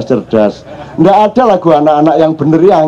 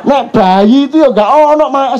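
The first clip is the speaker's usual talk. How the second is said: usual speaking voice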